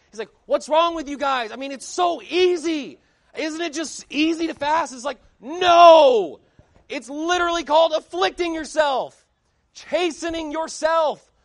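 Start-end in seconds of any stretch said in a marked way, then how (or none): 0.5-4.9 high, loud mock-incredulous pulpit rant; screaming preacher. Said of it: "screaming and slamming the pulpit". 5.4-11.3 drawn-out, high shouted rebuttal; exasperated shout. Acted. Exasperated retort to the preacher's claim.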